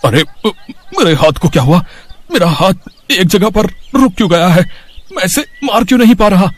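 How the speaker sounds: rising alarm as his hand won't move; baffled panic. Acted. Thug's hand frozen mid-blow. (हैरान घबराहट)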